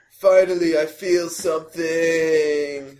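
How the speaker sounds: high, loud, drawn-out melodramatic cry; hammed-up proclamation, stretching the last word; dramatic revelation. Goth player hit by a bludger, hammed-up outburst. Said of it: "and they go".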